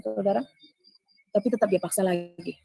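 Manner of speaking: ordinary talk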